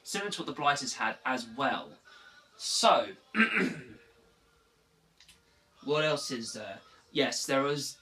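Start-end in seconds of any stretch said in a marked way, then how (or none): 3.1-4.0 throat clearing. Real, not acted. Clearing throat before continuing.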